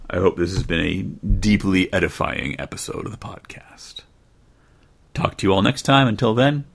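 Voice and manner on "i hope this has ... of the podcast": breathy whisper, mock solemn; whispered aside. Podcaster signing off the story to listeners, in a whisper. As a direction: whisper it like a secret joke.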